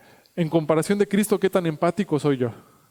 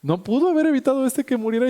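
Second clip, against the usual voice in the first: much higher, pointed complaint; reproachful onlookers; 8 semitones higher than usual. Retold or imitated. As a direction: throw the complaint at Jesus, voice up. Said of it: "los judíos que empiezan a reprocharle".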